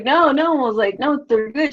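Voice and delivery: high-pitched imitated protest; hasty backpedalling. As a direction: placate an offended grandma, hurriedly backing down. Backpedalling to an offended nana.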